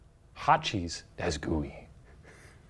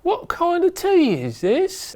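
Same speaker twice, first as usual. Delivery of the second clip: high, slow questioning voice; 14 semitones higher, 3 dB louder, slower than usual. Retold or imitated. Voicing the suspicious victim.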